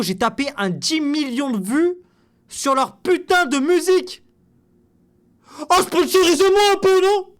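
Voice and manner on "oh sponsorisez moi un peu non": high, loud pleading call to the brand; loud mock-desperate begging for money. Real, not acted. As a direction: shout your plea at the sponsor, half joking.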